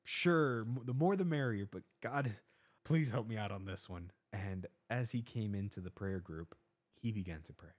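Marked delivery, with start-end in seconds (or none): 0.0-4.1 loud, high jittery yes; blurting out a nervous welcome; anxious man agreeing while silently begging God; nervous reply. Retold or imitated. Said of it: "anxious the way I was".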